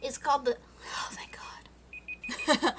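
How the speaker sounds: unvoiced murmured relief; whisper to herself. Real, not acted. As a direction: breathe it under your breath. Reading off-mic to herself.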